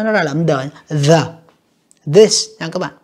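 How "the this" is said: "the, this" with a buzzing voiced TH. Shown as a demonstration. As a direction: let the vocal cords vibrate on TH in "the, this". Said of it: "nếu các bạn đọc rung lên". (đọc rung âm TH trong "the, this")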